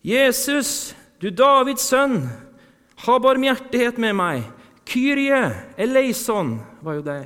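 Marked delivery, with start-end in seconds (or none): 0.0-4.5 loud, high desperate cry to Jesus. Acted. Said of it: "satte han i å rope". 4.9-6.6 loud, high cry on Kyrie. Acted. Preacher echoes the blind man's cry.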